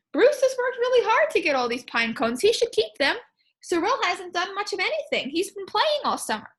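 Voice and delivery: quick, lifted pleading. Acted. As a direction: argue it fast and high. Voicing the other opinion.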